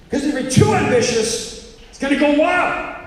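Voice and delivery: high, loud cautionary preaching; hammed-up alarm about ambition; mock warning. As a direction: warn them as if disaster looms. Voicing an alarmed moral warning.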